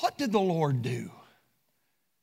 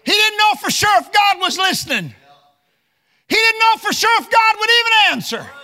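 loud, high preacher's shout; fiery preaching; 10 dB louder than usual. Acted. Preacher crying out to the congregation, loud and high. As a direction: preach it full force from the pulpit. Preacher building to full force.

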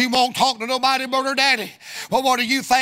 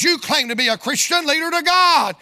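raised challenge, peaking high on the ending; retold unintended harshness; 6 semitones higher, 3 dB louder than usual. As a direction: re-enact the blurted rebuke. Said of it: "I didn't mean it to sound this way".

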